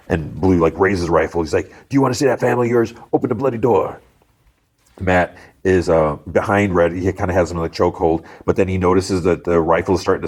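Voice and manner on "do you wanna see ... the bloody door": fast, higher villain voice; threatening robber. Retold or imitated. Robber Blue raises his rifle at bank man, fast and threatening. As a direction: threaten him in a rapid put-on voice.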